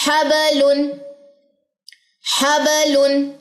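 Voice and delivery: हबलुन with a bouncing ब; qalqala recitation. Shown as a demonstration. Tutor demonstrates qalqala on the word for students. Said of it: "कलकले के साथ ही".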